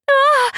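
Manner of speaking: very high, loud scream of alarm; a sudden frightened shriek; a girl shrieking in panic. Acted. Cedar screams at axe.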